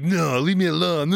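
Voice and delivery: light mock French accent; a despondent Twi'lek in French accent. GM demos his despondent Twi'lek, French accent. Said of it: "But the French accent".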